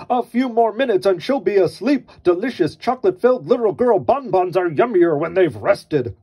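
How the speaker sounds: gloating dinosaur plotting dinner. Acted. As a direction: savor it like a hungry beast. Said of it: "a dinosaur gloating".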